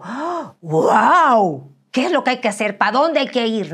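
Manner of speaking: loud, high exclamations of awe; excited. Retold or imitated. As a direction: burst out filled with joy. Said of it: "que digo".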